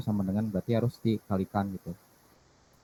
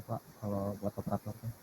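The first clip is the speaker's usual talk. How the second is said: too quiet; 11 dB quieter than usual. Real, not acted. Student asks teacher about comparing operators, too quiet. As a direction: question fading very soft. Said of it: "Kurang keras".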